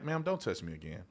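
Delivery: soft, low-key firm request; calm warning. Retold or imitated. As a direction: quietly set a boundary. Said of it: "It's like".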